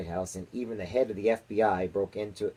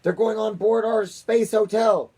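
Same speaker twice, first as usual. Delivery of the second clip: slow, high, loud cry; shouting; 11 semitones higher, 6 dB louder than usual. A narrator's reading. President cries out at the TV screen, slow and loud. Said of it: "shouted the president".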